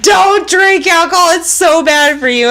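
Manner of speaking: high mock scolding; preachy school warning. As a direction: imitate a lecture to schoolkids. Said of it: "they'll be like".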